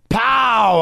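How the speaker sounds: sudden high pow; burst of energy. Real, not acted. Hit by the coffee, excited pow. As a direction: punch it out.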